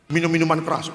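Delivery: normal talking voice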